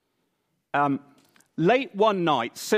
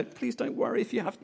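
higher, softer apologetic voice; timid polite Brit; 8 semitones higher, 7 dB quieter, faster than usual. Pretend. What Cyril is not, a timid apologetic knock, higher and softer. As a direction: apologise meekly, mock-timid. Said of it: "No, no".

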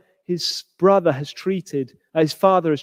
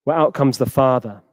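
normal talking voice

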